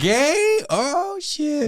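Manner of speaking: high shocked squeak; real shocked high squeal; surprised. Real, not acted. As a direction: gasp it out in shock. Shocked reaction.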